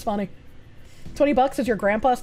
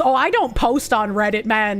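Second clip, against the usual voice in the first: ordinary talk, nothing marked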